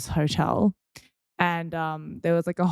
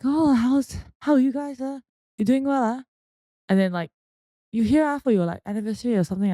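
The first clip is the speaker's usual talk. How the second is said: nosy Malaysian uncle, higher and lilting; Malaysian accent; 7 semitones higher, 3 dB louder than usual. Acted. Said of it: "I'm just doing a Malaysian accent".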